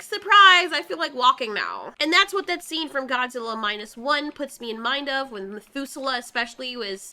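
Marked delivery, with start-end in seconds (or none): 0.0-1.9 mock cheery surprise. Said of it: "waking up like".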